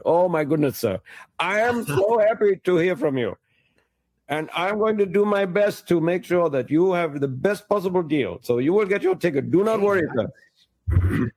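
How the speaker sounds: gushing, starstruck Indian agent; excited Indian accent. Retold or imitated. Indian travel agent realises he is talking to famous Jim, excited Indian accent. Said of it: "I'll do his voice".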